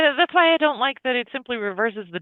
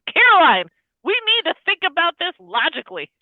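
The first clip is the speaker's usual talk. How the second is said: urgent raised voice; 6 semitones higher, 4 dB louder than usual. Retold or imitated. Father grabbing daughter's shoulders.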